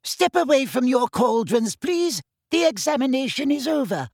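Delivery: put-on elderly examiner. A narrator's reading. Old examiner telling students to stop, high thin old voice. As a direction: announce the end of the exam like a very old examiner. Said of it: "When Professor Marchbanks said".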